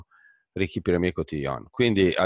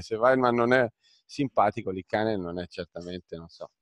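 ordinary talk

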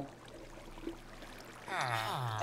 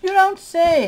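sarcastic; 13 semitones higher, slower than usual. Real, not acted. Sarcastic reply to obvious remark.

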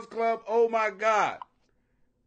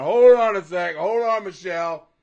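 much louder, cutting the chat off; loud interruption; 9 dB louder than usual. Real, not acted. Halting the chat before it goes further, loud. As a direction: stop them dead, loud.

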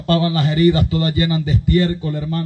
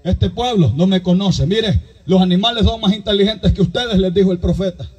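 raised, driving pulpit voice, pushing each phrase; fiery preaching; 5 semitones higher than usual. Acted. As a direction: preach it to the whole congregation. Preacher retelling Saul story.

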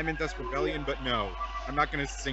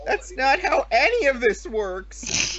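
exasperated; 9 semitones higher, 6 dB louder, much slower than usual. Pretend. Exasperated meme line.

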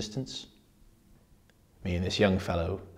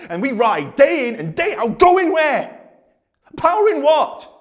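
rising to high, loud demands; angry rant; 9 dB louder, slower than usual. Acted. Speaker demanding answers from the audience, angry and loud. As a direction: hurl the questions out, outraged.

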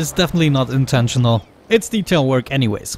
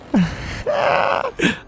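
burst of laughter; 9 semitones higher, 4 dB quieter than usual. Real, not acted. Laughing at funny airplane sounds.

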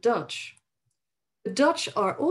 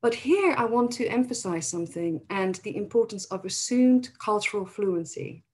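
normal talking voice

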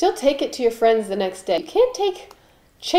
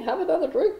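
normal talking voice

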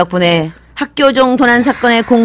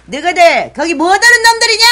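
angry yell; 7 semitones higher than usual. Acted. Principal catches boys at night.

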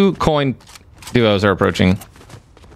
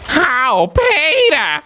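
high whiny cartoon wife; Lois voice; much slower than usual. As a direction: whine it like Lois Griffin. Family Guy Lois impression.

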